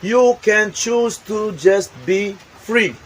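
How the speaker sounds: ordinary talk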